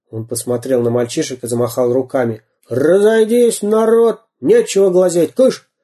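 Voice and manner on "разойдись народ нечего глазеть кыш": громкий окрик. Acted. Пират разгоняет толпу.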